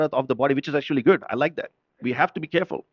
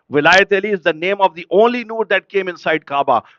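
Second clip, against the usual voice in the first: impassioned, rhythmic sermon chant, building louder; fervent preaching; 5 dB louder than usual. Acted. Preacher tells congregation when to say Ya Ali, fervently. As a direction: rouse the congregation with each repeated refrain.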